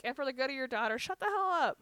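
high, loud retold telling-off; exasperated scolding. Woman retelling her telling-off of the neighbour, exasperated. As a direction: let the pitch climb into an exasperated yell.

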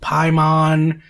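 Paimon said PIE-mon, with a full second vowel; mispronunciation. Shown as a demonstration. Showing co-host another mistake, Paimon said loudly as PIE-mon. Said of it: "How are people saying it?".

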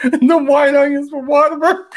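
high, breaking into loud laughter; laughing hard. Real, not acted. Vlogger cracking herself up over onion smell, laughing. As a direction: laugh through the words helplessly.

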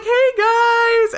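very high, falsely chummy hello; Trevor greeting. Retold or imitated. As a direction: sing it out like a fake buddy. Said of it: "He's like".

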